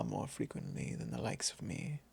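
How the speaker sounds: a sly breathy whisper; whispered aside. Acted. Vampire whispering a teasing aside to the hunter.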